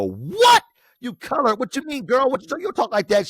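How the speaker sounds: high, loud, shocked disbelief. Retold or imitated. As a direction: squeal it out, scandalized. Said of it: "And we go".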